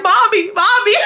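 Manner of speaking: high, whiny mock pleading; hammed-up little-kid whimper through laughter; pleading child. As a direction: call for mom, begging. Retold child pleading with mother.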